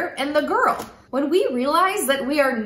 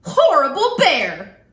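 louder, slow and cross; slow angry yell; 6 dB louder than usual. Acted. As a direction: drag it out in a huff. Girl arrives home still angry.

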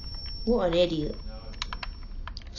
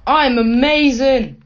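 loud, drawn-out brag; excited boast; 5 semitones higher, 8 dB louder, slower than usual. Real, not acted. Player bragging to himself after a kill, loud slow boast. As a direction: crow about it, louder and higher.

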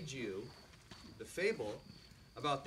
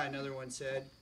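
usual speaking voice

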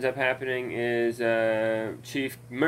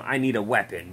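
imitating Chief line; 3 semitones lower, faster than usual. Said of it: "like that again".

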